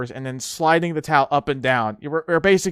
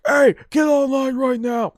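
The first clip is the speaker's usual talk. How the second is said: high shouted order; ordinary yell; 10 semitones higher than usual. Retold or imitated. Recruit imagines a drill instructor's usual order, shouted high. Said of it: "you would think they'd be yelling like".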